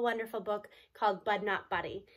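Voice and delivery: ordinary delivery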